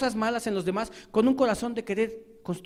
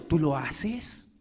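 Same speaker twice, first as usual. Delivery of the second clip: lower, very slow and pointed; slow retort; 5 semitones lower, 4 dB quieter, much slower than usual. Acted. Preacher answering the accuser, slow and pointed. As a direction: lay it down word by word.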